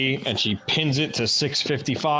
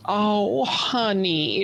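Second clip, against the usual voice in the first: high, cooing Southern sympathy; comforting Southern receptionist; Southern accent; 6 semitones higher, much slower than usual. Acted. Receptionist consoles jilted Daphne, Southern drawl. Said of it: "why the receptionist is Southern".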